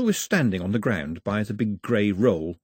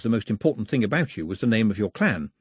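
plain reading voice

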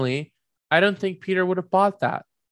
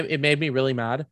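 ordinary talk

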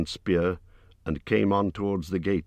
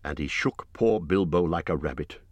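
usual speaking voice

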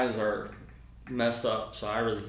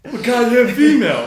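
high loud laughing rebuke; laughing indignant retort; 12 semitones higher, 14 dB louder than usual. Retold or imitated. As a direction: snap back, cracking up. Offended female officer retorts.

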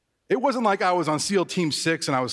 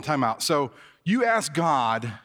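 ordinary talk

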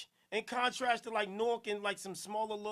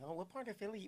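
softer teasing Karen imitation; mock Karen voice; 2 semitones lower, 11 dB quieter, faster than usual. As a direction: mimic a Karen, much softer. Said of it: "had to do the Karen voice".

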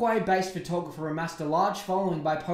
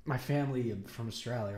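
usual speaking voice

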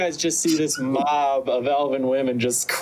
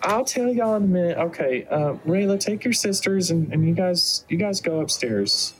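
higher motherly voice; doting mother, organizing; 8 semitones higher than usual. Acted. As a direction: shoo the children along as their mother.

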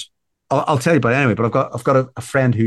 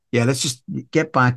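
ordinary delivery

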